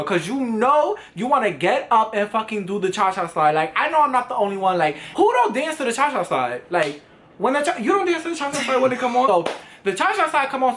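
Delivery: usual speaking voice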